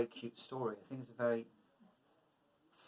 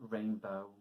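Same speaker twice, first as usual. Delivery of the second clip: usual speaking voice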